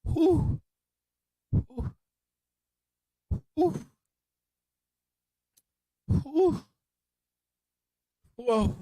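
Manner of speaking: high breathy gasps after a harsh shot; burning-throat exhale. Real, not acted. Streamer gasping after a dry shot. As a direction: blow out the burn of the liquor. Reacting to strong liquor.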